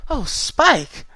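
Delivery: high, loud gasping cry of wonder; gasped exclamation. A narrator's reading. Fluttershy moved by Spike's promise, gasping high and loud. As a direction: gasp out his name, moved. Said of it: "Fluttershy gasped".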